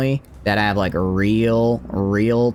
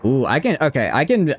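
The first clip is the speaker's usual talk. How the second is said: usual speaking voice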